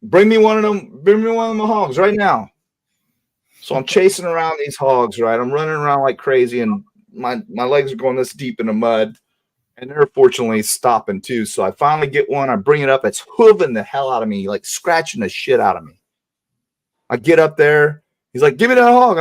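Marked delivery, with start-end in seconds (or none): 0.0-2.5 higher, mock-bossy yell of an old man; retold gruff order. Grandfather orders the boy to catch a hog, hammed-up yell. 18.6-19.2 much higher, louder impatient shout; retold demand. Grandfather grabs for the hog from the boy, impatient shout.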